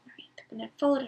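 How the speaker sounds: ordinary delivery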